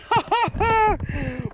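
high, giddy burst of laughter; elated high laugh. Real, not acted. Angler alone with record fish, elated high laugh. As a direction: laugh high in sheer disbelief. Overjoyed after landing the fish.

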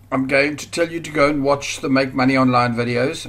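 ordinary talk

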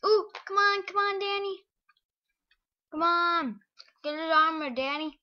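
high, loud cheering; excited cheering. Real, not acted. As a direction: cheer a friend on loudly. Cheering teammate on.

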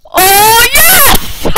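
very loud, rising yell of victory; triumphant yell. Real, not acted. Player winning a round of the game, triumphant yell. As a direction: belt it out, climbing high.

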